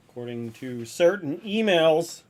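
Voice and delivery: loud, slow, pointed sarcasm; sarcastic. Acted. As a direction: lean into it knowingly. Teasing denial to a viewer.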